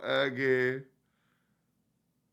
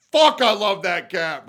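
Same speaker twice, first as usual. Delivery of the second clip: louder burst of love; loud gush; 4 semitones higher, 9 dB louder than usual. Real, not acted. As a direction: blurt it out overwhelmed. Overwhelmed by cat footage.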